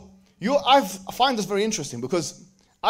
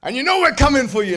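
louder, higher joking menace; hammed-up threat, then take it back; playful fake warning; mock threat; 7 semitones higher, 7 dB louder than usual. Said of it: "No, only joking".

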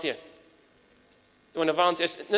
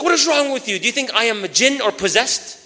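higher, louder offended retort; indignant outburst; 5 semitones higher, 5 dB louder than usual. Retold or imitated. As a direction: snap back, insulted at the advice. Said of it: "He's like".

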